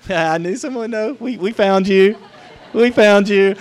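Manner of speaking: high and loud, laughing excitement; happy shout of found you; excited. Real, not acted. Someone in crowd answers.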